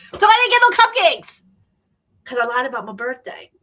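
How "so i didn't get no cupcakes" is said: much higher, louder laughing words; high giggly no cupcakes; laughing punchline. Real, not acted.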